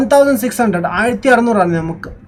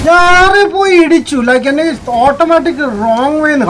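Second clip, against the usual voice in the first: frustrated shout; 7 semitones higher, 6 dB louder than usual. Real, not acted. Frustrated gamer after crash.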